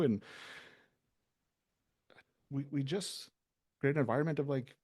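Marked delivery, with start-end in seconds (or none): none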